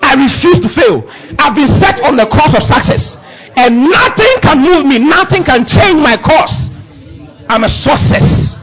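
high, loud declaration of success; preaching at full force. Acted. Preacher declaring victory over his own life, full force. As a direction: proclaim it like a victory cry from the pulpit.

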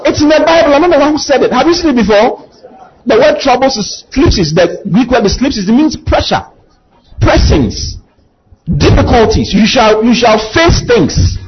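high, pressing preacher voice; preaching at full force. Acted. Preacher pressing the Bible's warning on the church. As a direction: drive it home to the flock.

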